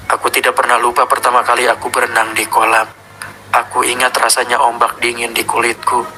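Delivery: a sinister Godzilla speaking from the tape; monster voice. Acted. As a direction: growl out the kaiju's confession.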